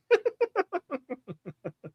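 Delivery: high, trailing giggles; soft, high-pitched laughing fit; real laughter. Real, not acted. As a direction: laugh until it fades away. Cracks up at the accent attempt.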